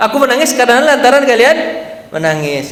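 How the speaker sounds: amused, laughing through Umar's reply; laughing retelling. Retold or imitated. Preacher retells Umar's answer to his friends, laughing. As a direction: chuckle through the quote.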